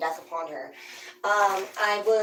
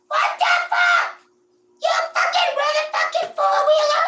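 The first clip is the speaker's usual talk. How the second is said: hammed-up angry mother; furious mom yell; 6 dB louder than usual. Mom yelling at the kids about the four-wheeler, furious shout. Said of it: "And my mom".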